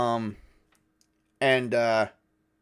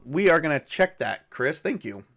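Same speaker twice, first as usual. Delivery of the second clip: normal talking voice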